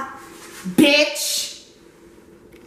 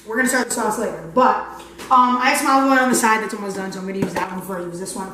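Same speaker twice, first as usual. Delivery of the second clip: normal talking voice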